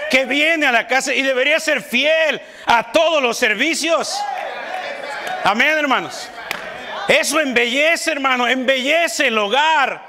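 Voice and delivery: high, loud fiery preaching; preaching at full force. Acted. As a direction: drive it home to the congregation. Preacher raising voice to congregation.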